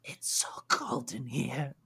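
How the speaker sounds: hissing, rasping lizard voice that swells to wild shouts; a cold, furious captive beast begging to be let out; raging lizardfolk prisoner, pleading for heat; angry trapped lizard creature. Acted. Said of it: "clearly angry and irate, cursing".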